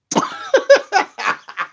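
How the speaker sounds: high burst of laughter; real laughter. Real, not acted. Vlogger cracking up at his own impression.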